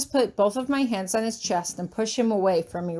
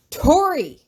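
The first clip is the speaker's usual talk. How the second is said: loud, sharp yell of her name; angry shout; 6 semitones higher, 7 dB louder than usual. Acted. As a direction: bark her name to snap her out. Hieronymus shakes her, shouting.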